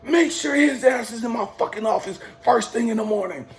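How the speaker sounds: high, hollered command in imitation; mock commander's order. Commander instructs sergeant about recruit, mock yelled order. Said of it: "he points to the sergeant".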